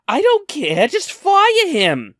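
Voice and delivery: high, loud, drawn-out mock shriek; a tantrum pitched sky high; shrieking demand. Furious customer shrieking at the manager to fire him, very high and loud.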